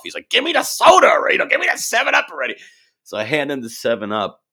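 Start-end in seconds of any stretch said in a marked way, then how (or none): 0.3-2.5 high loud impatient demand, fast; pissed-off dad. Retold or imitated. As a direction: snap at the kid like an angry father. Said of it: "he's all pissed off".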